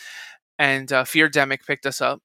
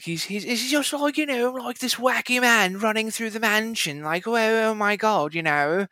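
high, wacky English comic voice; mock a zany, high-pitched British babbler; Russell Brand; 8 semitones higher than usual. Voice actor mocking the old Padre's voice, high wacky impression. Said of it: "he sounds like Russell Brand".

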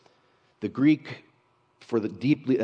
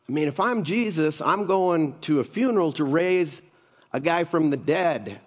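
ordinary talk

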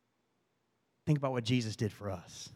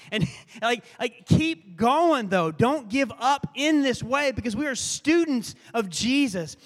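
impassioned preaching; 7 semitones higher, 4 dB louder than usual. Real, not acted. Pastor urging students of Jesus not to give up, impassioned. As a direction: build to high, urgent exhortation. Preacher building to an urgent exhortation.

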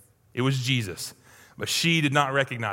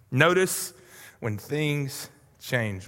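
slowed repeat; 3 semitones lower, much slower than usual. Shown as a demonstration. Preacher repeating it slowly for the congregation. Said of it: "But notice when things change".

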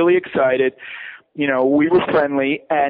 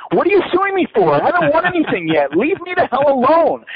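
exasperated outburst; 10 semitones higher than usual. Retold or imitated. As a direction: snap back, out of patience, pitched way up. Retelling his exasperated reply to threats.

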